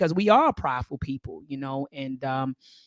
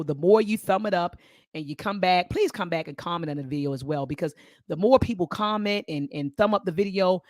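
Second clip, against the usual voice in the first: ordinary delivery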